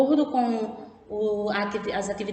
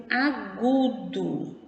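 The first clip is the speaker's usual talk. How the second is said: "agudo" stretched out slowly, syllable by syllable; emphatic naming; much slower than usual. Shown as a demonstration. Teacher naming the accent for pupils, slow emphatic stress. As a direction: draw out each syllable of "agudo".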